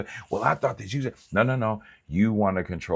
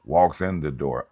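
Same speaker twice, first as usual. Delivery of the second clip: ordinary talk, nothing marked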